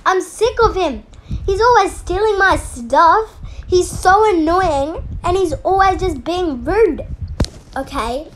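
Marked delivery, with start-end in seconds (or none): 0.0-7.1 yelling. Acted. Girl yelling to the teacher about Jamal, angry and loud. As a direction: shout out the grievance, fed up. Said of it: "no need to yell".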